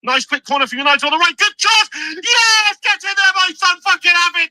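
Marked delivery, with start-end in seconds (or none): 1.4-4.5 real joy at a goal; goal celebration. Real, not acted. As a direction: roar it as the ball goes in.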